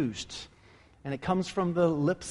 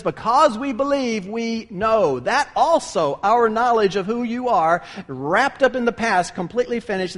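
fervent preaching; 5 semitones higher, 5 dB louder than usual. Acted. Preaching on Peter's words.

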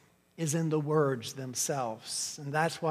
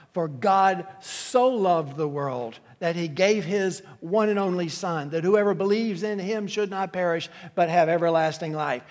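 scripture read with fervor; 5 dB louder than usual. Acted. Quoting John 3:16.

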